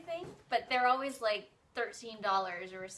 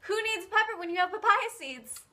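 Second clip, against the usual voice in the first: excited; 9 semitones higher, 5 dB louder than usual. Real, not acted. Excited about seeds.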